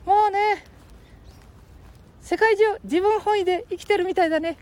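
put-on high squeaky boy voice; Ken-chan voice.

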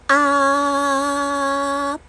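sustained comfortable ah in chest voice; chest voice ah demo. Shown as a demonstration. Vocal coach demonstrating a relaxed chest-voice ah. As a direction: hold a relaxed ah at speaking pitch. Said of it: "これぐらいが地声です".

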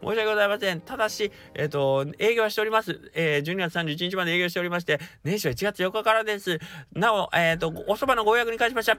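high polite phone voice; record it like a shop message; customer service voice. Shown as a demonstration. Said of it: "これが基本バージョン".

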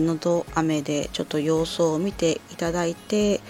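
ordinary talk

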